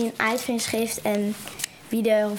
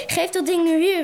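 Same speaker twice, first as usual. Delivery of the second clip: higher, urgent demand; calling out; 6 semitones higher, 4 dB louder than usual. A narrator's reading. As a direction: call out to get the gun back. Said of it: "riep Storm".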